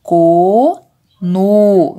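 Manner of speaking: CO and NO, each with a long held O; drawn-out vowel. Shown as a demonstration. Teacher comparing two syllable endings, drawn-out CO, NO. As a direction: stretch both syllables on the same O. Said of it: "o som final da sílaba é o mesmo".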